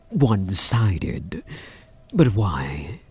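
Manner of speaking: ordinary talk, nothing marked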